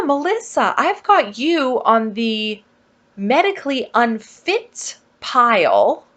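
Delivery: put-on a sweet office girl breaking odd news brightly; chirpy receptionist. Voicing the sweet booking girl.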